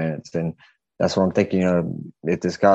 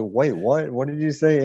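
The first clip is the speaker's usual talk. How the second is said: retold shock, pitched up high; 6 semitones higher than usual. Storyteller asks the stranger to repeat himself, shocked. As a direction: replay a stunned double take. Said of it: "like, shocked".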